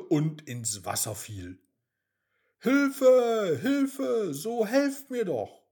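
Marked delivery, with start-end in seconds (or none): 2.6-3.6 loud, higher, desperate call; desperate cry for help. Acted. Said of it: "rief Mimi laut und verzweifelt". 3.6-4.3 desperate call. Acted. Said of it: "rief Mimi laut und verzweifelt". 4.3-5.6 desperate call. Acted. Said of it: "rief Mimi laut und verzweifelt".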